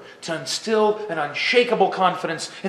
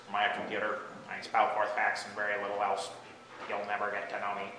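low, soft robotic monotone; computer voice; 8 semitones lower, 12 dB quieter than usual. Acted. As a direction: drone it on one note like an impersonal machine. Acting as an impersonal computer.

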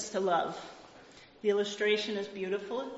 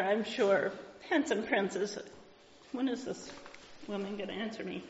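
normal talking voice

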